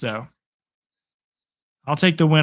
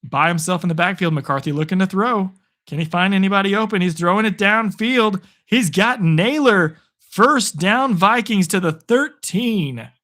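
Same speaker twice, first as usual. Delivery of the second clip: brisk, raised sportscaster call of the drive; play-by-play call; 5 semitones higher than usual. Acted. Streamer calling his own game to viewers, play-by-play voice. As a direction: call the pass like a TV announcer.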